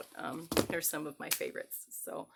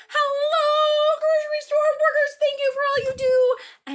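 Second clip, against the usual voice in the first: much higher and louder, long drawn hello; cheerful shout; 16 dB louder than usual. Shown as a demonstration. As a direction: call out to the farmers.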